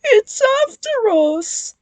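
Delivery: high, loud alarmed cry; frightened cry. A narrator's reading. Ben fleeing the ghost, crying out. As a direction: blurt it in fear. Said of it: "finished Ben".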